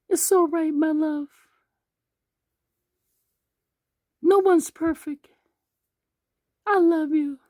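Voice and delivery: very high falsetto wife voice; forgiving wife. Acted. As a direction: reassure him in a tiny, high put-on voice. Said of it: "And she's like".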